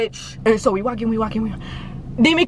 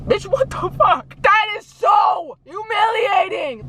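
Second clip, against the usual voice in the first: outraged; 8 semitones higher, slower than usual. Real, not acted. Outraged at pig men.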